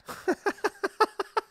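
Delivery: long high laughing fit; real, helpless high laughter. Real, not acted. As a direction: crack up at own innuendo. Cracks up at innuendo.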